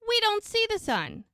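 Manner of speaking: high, loud exasperated retort; mock exasperated protest; acted exasperation. Acted exasperated reply in story.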